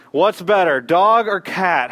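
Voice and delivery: ordinary talk, nothing marked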